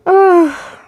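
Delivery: a high, pained groan of dread. Real, not acted. Reader dreading the tape ahead, pained groan. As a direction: groan before pressing play.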